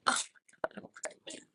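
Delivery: breathless whisper of disbelief; hushed, stunned whisper; barely voiced oh my God; whispered shock. Retelling finding positive test.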